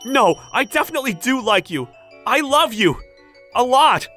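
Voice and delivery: loud, high, eager insistence; emphatic love declaration. Acted. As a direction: declare it at full voice, leaving no doubt.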